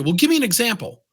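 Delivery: defensive reply. Acted. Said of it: "what do we do?".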